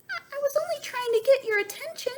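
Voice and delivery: very high, fast nervous voice; the bird explaining herself; little bird. A narrator's reading. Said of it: "said the little bird".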